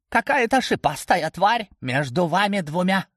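high-pitched, excited creature voice; creature's alert report. A narrator's reading. Familiar reports the spiny beast to the hero, high alert.

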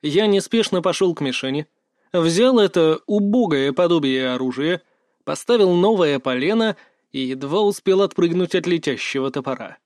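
plain reading voice

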